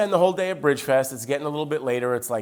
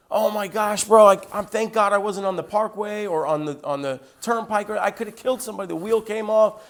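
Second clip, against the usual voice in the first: higher-pitched, retold agitated distress; very upset; 7 semitones higher than usual. Truck driver after his wheel came off, very upset. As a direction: imitate a shaken stranded driver. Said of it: "very upset".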